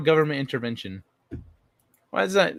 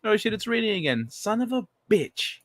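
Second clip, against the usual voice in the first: exasperated cursing; 8 semitones higher than usual. Real, not acted. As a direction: curse the bad luck, stretching the last words. Annoyed to find it raining again.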